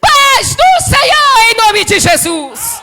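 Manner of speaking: answering full of grace. Shown as a demonstration. Preacher shows the church how to answer the greeting, slow triumphant shout. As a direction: stretch every word out like a triumphant shout of faith. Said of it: "você responde cheio da graça de Deus e diz".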